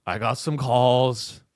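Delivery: a half-hearted cop shrugging it off; a hint of a bored cop who didn't want to come; lazy cop.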